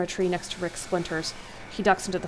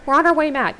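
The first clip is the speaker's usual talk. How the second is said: urgent reply; 8 semitones higher, 8 dB louder than usual. Acted. Riley answers over radio.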